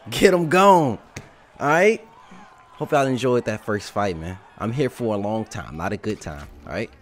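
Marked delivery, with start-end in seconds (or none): 0.1-2.1 higher, louder hyped GOAT boast. Pretend. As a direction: boom it out like the champ. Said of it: "Goat voice".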